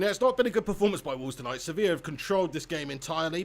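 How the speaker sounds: normal talking voice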